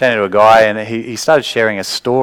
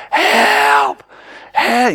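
high, a little louder desperate cry; pretend scream for help; 12 semitones higher, 4 dB louder than usual. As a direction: scream as if trapped and crushed. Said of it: "You're screaming for your life".

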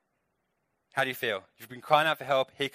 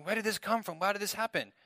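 higher, softer voice of bewildered people; mock bewildered questions, pitched up and hushed; baffled complaint; 7 semitones higher, 5 dB quieter than usual. Israelites asking why judgment has come, baffled complaint.

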